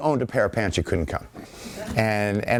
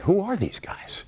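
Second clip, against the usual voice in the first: higher, softer baffled question; mock astonishment; 10 semitones higher, 4 dB quieter than usual. British troops seeing the new army, hushed astonishment. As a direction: ask it like a stunned onlooker. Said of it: "I call it their Butch and Sundance moment".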